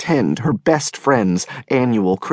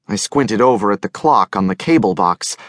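ordinary delivery

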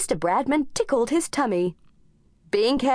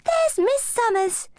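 high eager pointing out; a girl spotting a friend; excited child; 13 semitones higher than usual. A narrator's reading.

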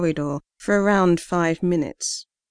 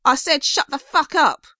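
furious repeat, higher and louder; angrier gruff shout; 8 semitones higher, 3 dB louder than usual. Acted. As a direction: yell it harder, higher and louder. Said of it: "gruff voice repeated".

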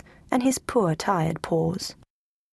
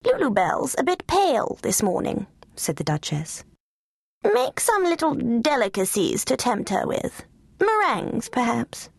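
high, fluting lady's orders; grand fussy Duchess; 5 semitones higher than usual. Acted. Duchess ordering Cook, high and fluting. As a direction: dote and command at once.

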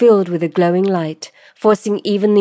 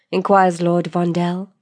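ordinary delivery, nothing marked

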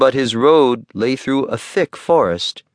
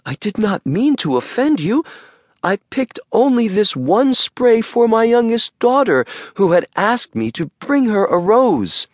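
pleading in terror; 9 semitones higher than usual. Acted. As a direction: beg for your life.

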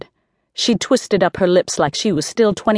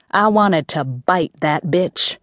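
ordinary delivery, nothing marked

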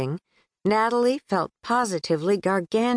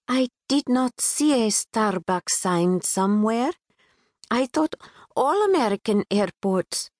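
put-on a touch of Pakistani accent; 4 semitones higher than usual. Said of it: "a bit sing-song, infused with a British twang".

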